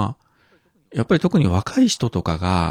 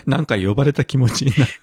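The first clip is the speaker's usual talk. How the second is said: amused quoting through laughs; laughing; 6 semitones higher, 3 dB louder than usual. Real, not acted. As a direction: chuckle while quoting. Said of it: "さすが姫".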